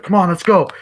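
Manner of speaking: high, loud rallying call; hammed-up high, loud urging; mock urgent call. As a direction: hurry everyone out. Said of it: "It's like".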